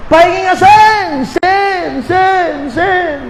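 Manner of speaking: high-pitched far-off call with a fading echo; calling across mountains. Acted. Preacher acting out a talk with someone across the mountain, high echoing call. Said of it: "Tapos ang kausap mo nasa kabilang bundok".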